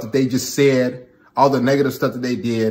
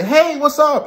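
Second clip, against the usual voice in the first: high, sing-song casual hello; mock breezy girlfriend; 13 semitones higher than usual. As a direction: toss it off lightly, way up high. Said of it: "Then she'll say".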